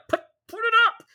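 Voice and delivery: light mock-voice of the author urging; imitating the author. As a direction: squeak it out like an eager friend pushing you. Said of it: "the author of the fic was like".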